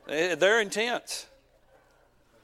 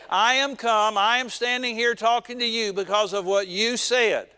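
louder, higher, emphatic herald; angel's proclamation; 4 semitones higher, 3 dB louder than usual. Acted. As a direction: proclaim it firmly as the angel to Daniel. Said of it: "reporting what the angel said to Daniel".

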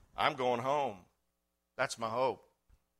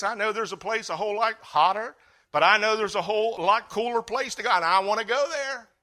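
ordinary delivery

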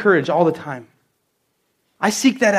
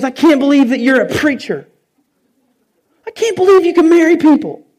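astonished; 7 semitones higher, 4 dB louder than usual. Retold or imitated. Retold lady's astonished remark.

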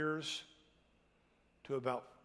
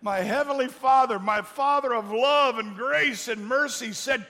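full-force preaching; 9 semitones higher, 13 dB louder than usual. Acted. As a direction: preach it out to the back row.

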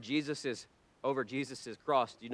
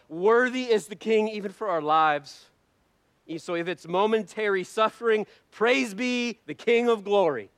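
loud, high, full-force sermon delivery; fiery preaching; 7 semitones higher, 9 dB louder than usual. Acted. Preacher urging the congregation, loud and high. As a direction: preach it at the top of your voice.

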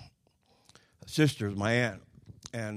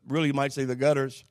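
ordinary delivery

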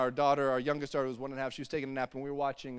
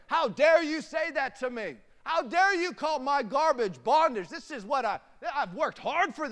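high, loud, affronted protest; hammed-up indignant preacher's retort; mock outrage; 11 semitones higher, 5 dB louder than usual. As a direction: throw it back as deeply offended. Acted offence at being challenged.

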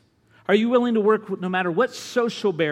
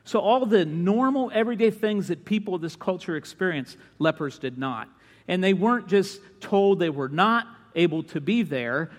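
ordinary talk, nothing marked

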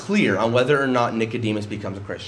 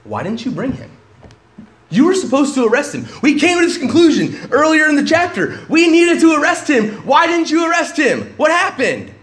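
high, loud shouting demand; angry Pharisees; 11 semitones higher, 6 dB louder than usual. Retold or imitated. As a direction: bark the accusation at the officers. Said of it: "who said to them".

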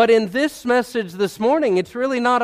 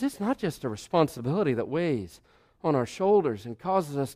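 much softer, intimate sermon voice; hushed preaching; 3 semitones lower, 8 dB quieter than usual. Acted. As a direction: speak it softly as a confession of faith. Quiet reflective preaching stretch.

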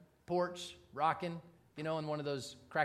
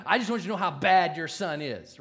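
louder, blunt accusation; angry jab; 9 dB louder than usual. Retold or imitated. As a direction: spit out the worst thing he can say. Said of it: "but this guy's mad".

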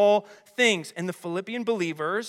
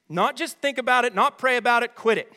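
higher, louder, punched-out orders; insistent command; 4 semitones higher, 5 dB louder, faster than usual. Retold or imitated. Preacher voicing Paul telling believers to stop, insistent. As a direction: press each order home as Paul would.

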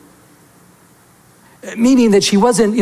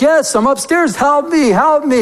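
higher-pitched imitation of calling from upstairs; mock yelling; 7 semitones higher, slower than usual. Preacher plays trapped man answering from upstairs, mild mock yell. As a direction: call for help, half-voiced yell. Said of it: "he kept yelling".

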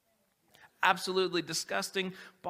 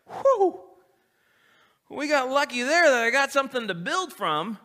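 much higher, loud, hammed-up sigh of relief; mock relieved Jesus; 7 semitones higher, 8 dB louder than usual. Pretend Jesus relieved to have food to start with, high and loud. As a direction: play it like a lucky escape. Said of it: "Jesus was like".

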